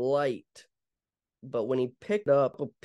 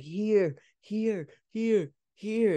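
imitated urgent cry; 7 semitones higher, much slower than usual. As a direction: echo his overwhelmed shouting lightly. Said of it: "He's just like".

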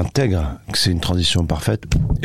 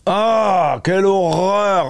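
mock horror; 11 semitones higher, much slower than usual.

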